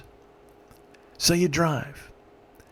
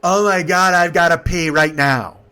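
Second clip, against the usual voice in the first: loud, higher mock complaint; urgent outburst; 4 semitones higher, 6 dB louder than usual. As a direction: imitate a desperate passenger announcing it. Retold passenger's urgent outburst.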